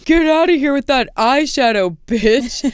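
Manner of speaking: lightly voiced drunk guy picking a fight; drunk heckler. Drunk guy yelling at her.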